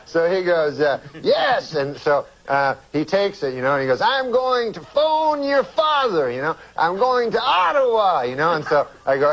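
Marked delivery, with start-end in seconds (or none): 1.2-1.7 Bill Cosby impression. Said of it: "my Cosby". 4.0-8.2 high, slow Cosby voice; slow, high and drawn out; Bill Cosby impression. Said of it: "my Cosby".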